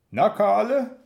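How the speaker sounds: ordinary delivery, nothing marked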